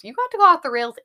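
loud. Acted. Repeats line, second louder.

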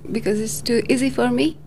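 usual speaking voice

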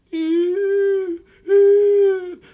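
loud, high-pitched bawling; exaggerated long crying howl; mock child's wail. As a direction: wail like a kid who fell. Imitates fallen child wailing to parents.

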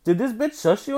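high, loud disbelief; indignant brother; outraged. Retold or imitated. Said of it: "he goes".

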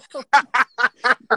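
big burst of laughter; loud laugh. Real, not acted. Laughing at chewing.

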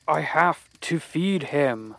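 slow, strained; desperate. Acted. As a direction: force it out slowly. Beaten man, creepy confession.

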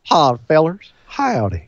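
co-host's echoed Southern twang; southern accent. Retold or imitated. Co-host echoes the greeting in a Southern accent. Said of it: "in my southern accent".

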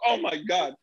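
high, softish retold gasp of embarrassment; mortified exclamation. Customer caught out before the cashier, mortified.

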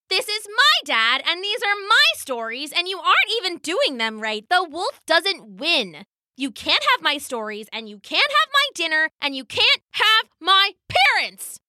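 put-on high-pitched angry little girl; indignant child yelling. A narrator's reading. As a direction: scold them as an outraged child. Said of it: "yelling all the way".

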